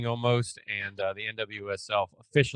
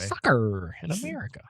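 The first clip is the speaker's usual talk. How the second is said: hammed-up American accent like a British actor; fake American accent; 3 semitones higher than usual. Shown as a demonstration. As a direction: overplay the American twang on "Soccer". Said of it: "like a British actor trying to do an American accent".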